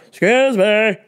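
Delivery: loud, demanding customer call; imitating the pushy shopper demanding service, loud; entitled customer. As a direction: snap for attention like you're owed it. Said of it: "And she's yelling".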